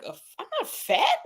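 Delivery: high, offended yelp; indignant high protest. Retold or imitated. To the boyfriend calling her fat, a high indignant protest. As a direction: squeal it like you've been insulted. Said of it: "I'm like".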